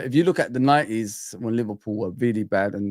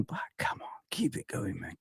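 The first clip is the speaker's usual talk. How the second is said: whispered urging; 6 semitones lower, 14 dB quieter than usual. Real, not acted. Quietly urging.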